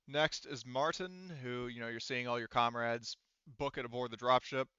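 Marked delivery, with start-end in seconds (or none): none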